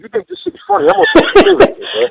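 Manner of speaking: high-pitched, worked-up outburst; retold angry threat. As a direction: replay your exasperated threat to a laughing friend. Retold angry threat while friend laughs.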